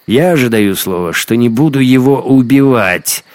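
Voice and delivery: loud, emphatic vow; proclaimed promise. A narrator's reading. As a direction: declare it forcefully, rising in pitch. Hunter loudly proclaims his promise.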